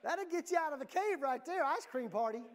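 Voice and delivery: happy outburst about ice cream for everyone; excited burst. Real, not acted. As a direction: shout it like the best news ever. Joking about an ice cream payoff.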